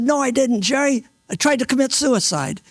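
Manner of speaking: imitating a desperate correction; agitated retold protest. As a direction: blurt it out as the upset young man. Retold protest of suicidal man.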